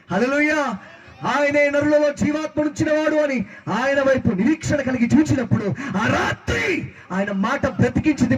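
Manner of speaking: fervent preaching. Real, not acted. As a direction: proclaim it high and insistent. Preacher declaims scripture. (ఉత్సాహంగా స్వరం పెంచి వాక్య ప్రకటన)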